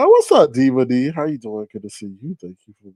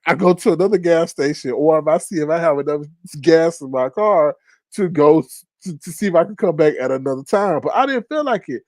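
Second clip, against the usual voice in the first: ordinary talk, nothing marked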